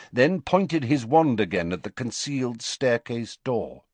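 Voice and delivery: ordinary delivery, nothing marked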